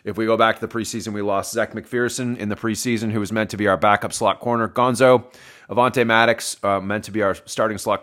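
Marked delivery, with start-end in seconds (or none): none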